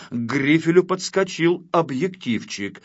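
usual speaking voice

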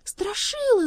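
high, loud cry to a friend. A narrator's reading. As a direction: call out his name urgently. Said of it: "закричала Элли".

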